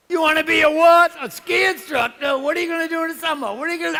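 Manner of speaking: high, loud, hammed-up scolding mother; mother's voice. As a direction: screech in disbelief like an appalled mom. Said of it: "I was my mother ... I had the voice, I had the tone".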